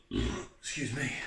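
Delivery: slow, low, strained through a burp. Real, not acted. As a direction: push the words out past a real burp. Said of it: "that hot bomb's repeating on me".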